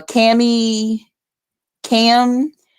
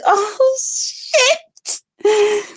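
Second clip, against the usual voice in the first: high, thrilled "Oh, shit" breaking off; excited squeal; 12 semitones higher, 3 dB quieter than usual. Retold or imitated. Reacting to her baby's performance, high and thrilled. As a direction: squeal with delight.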